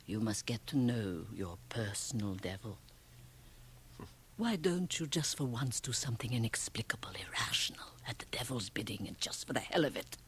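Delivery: whispered temptation; tempting whisper. Acted. Liesl urges Ramsay toward the irrational, whispering. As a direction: whisper it like the devil's bidding.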